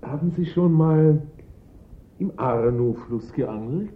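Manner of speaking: high, loud and slow, teasing; playful drawn-out tease to the boss; cheeky silly question. Acted. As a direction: sing it out like a cheeky joke. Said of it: "mit einer albernen Frage".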